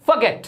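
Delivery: फॉरगेट as "fuh-GET", no R; weak first syllable in फॉरगेट; correct pronunciation. Shown as a demonstration. Teacher models for learners, फॉरगेट said "fuh-get". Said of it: "ये होता है".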